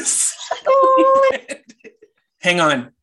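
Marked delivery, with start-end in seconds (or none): 0.1-1.6 high, slow teasing repeat; mock posh echo. Mocking repeat of phrase.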